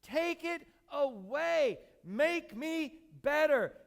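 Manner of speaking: slow, loud drawn-out plea; pleading. Retold or imitated. Paul pleading slowly with God for healing. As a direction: stretch each word as if begging.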